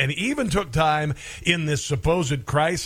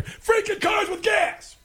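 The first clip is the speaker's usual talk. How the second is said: exasperated shout; slower than usual. Real, not acted. Host venting at gas prices.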